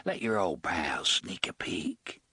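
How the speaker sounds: soft thick voice. A narrator's reading. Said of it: "in a soft, thick voice".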